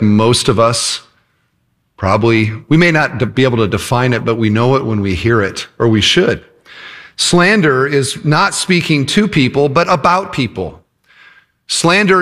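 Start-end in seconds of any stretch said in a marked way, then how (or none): none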